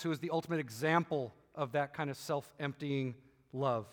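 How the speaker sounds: hushed, unhurried pastoral prayer voice; closing prayer. Acted. Pastor prays for the moms in the congregation, gentle and reverent.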